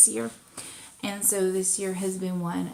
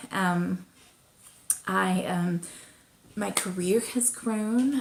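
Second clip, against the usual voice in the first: ordinary talk, nothing marked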